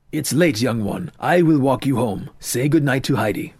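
a hint of a firm father; father sending daughter home. Father tells young one to say good night, mildly firm.

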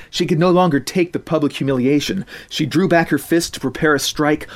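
plain reading voice

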